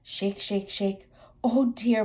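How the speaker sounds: usual speaking voice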